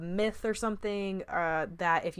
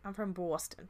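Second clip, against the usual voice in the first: lightly imitated Boston accent, softer; badly put-on Boston twang, tossed off; mock Boston accent; 7 dB quieter than usual. Podcast host mocks the actor's accent, fake Boston accent. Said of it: "sounded like if I was like".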